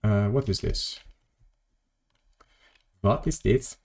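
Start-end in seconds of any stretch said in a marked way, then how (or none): 3.0-3.8 mock German accent, a bit higher. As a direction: put on a bad German accent. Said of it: "Making a deliberate mock of German accent".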